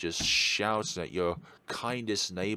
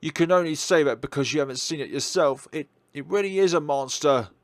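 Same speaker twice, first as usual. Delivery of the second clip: louder, pressing insistence; insistent; 4 semitones higher, 7 dB louder than usual. Acted. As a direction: force her to believe you. Insisting to doubting neighbour.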